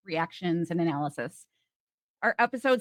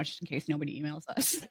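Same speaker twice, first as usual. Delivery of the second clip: ordinary delivery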